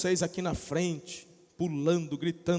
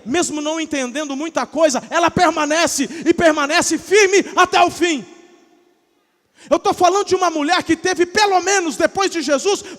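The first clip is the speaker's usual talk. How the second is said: much louder and higher, shouting the sermon; fiery preaching; 7 semitones higher, 10 dB louder than usual. Real, not acted. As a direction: preach at full force to the crowd. Preaching at full force. (pregação inflamada aos gritos)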